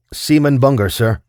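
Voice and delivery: reporting to captain. A narrator's reading. Young seaman reporting to his captain at attention, loud and high. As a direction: snap it out at attention.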